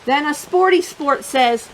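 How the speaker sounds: lively, expressive picture-book reading; reading to children. Acted. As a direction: tell the story to a room of little kids.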